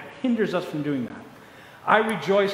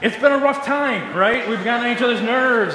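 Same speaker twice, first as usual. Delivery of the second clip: louder and higher, preaching out; 6 semitones higher, 5 dB louder than usual. Real, not acted. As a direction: call it out to the whole room. Preacher resumes, loud.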